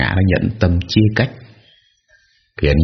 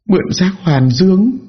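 very high, astonished echo question; shocked question; slower than usual. A narrator's reading. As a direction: repeat it back in disbelief. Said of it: "Tôi tròn mắt hỏi lại".

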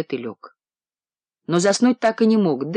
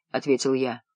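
ordinary delivery

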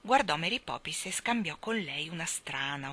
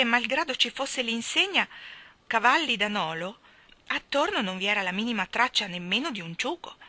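plain reading voice

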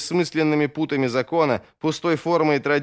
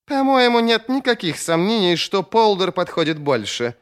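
high, testy outburst; exasperated objection, starting high; irritated exclamation; 8 semitones higher, 4 dB louder than usual. A narrator's reading. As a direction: cut in sharply with raised pitch, annoyed. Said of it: "раздражённо воскликнул Сульт".

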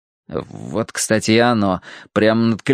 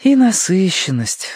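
drawn-out, higher sigh; 8 semitones higher, slower than usual. A narrator's reading. Verity commenting on the ugly stump, sighing. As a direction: sigh the words out slowly. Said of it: "вздохнула Верити".

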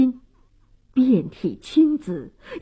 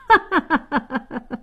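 long scornful villain's laugh; rapid staccato ha-ha of derision; mocking laugh; 4 semitones higher than usual. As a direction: laugh off the threat. Bully mocks the demand.

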